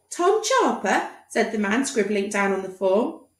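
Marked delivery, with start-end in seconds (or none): 0.1-1.1 high, slow questioning doctor; doubtful doctor. Acted. Doctor checking the name, slow high query. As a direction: repeat it doubtfully.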